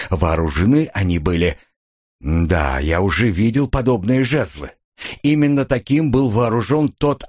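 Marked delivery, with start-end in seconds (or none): none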